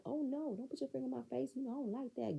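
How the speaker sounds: high-pitched mimicry of a complainer; put-on prissy protest; mocking objection. Mimics Miss Wanda's objection.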